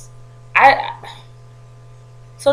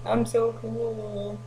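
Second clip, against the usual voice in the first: lazy too-cool mock; 13 dB quieter, much slower than usual. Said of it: "chewing his gum like".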